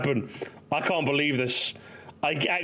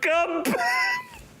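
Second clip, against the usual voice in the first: mock wailing call. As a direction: Come back! wailed very high and drawn out. Calling after kidnapped son.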